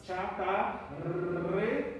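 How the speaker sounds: "Chatarreros" very slowly, syllable by syllable, long trilled rr; syllable-by-syllable model. Shown as a demonstration. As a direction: break "Chatarreros" into slow separate pieces for students. Teacher breaks word into syllables. ("Chatarreros" bem devagar, sílaba por sílaba)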